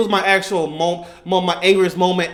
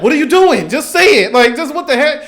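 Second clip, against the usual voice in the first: exasperated yell; 7 semitones higher, 4 dB louder than usual. Retold or imitated. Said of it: "And Yuki's like".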